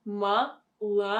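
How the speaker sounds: "Молодец" in separate syllables, ma - la - DYETS; syllable-by-syllable demo. Shown as a demonstration. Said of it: "Next word is молодец".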